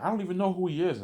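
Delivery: pitched high and loud, in disbelief; high incredulous protest. Retold or imitated. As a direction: squeak out that you've never heard of him. V J voiced in retold story.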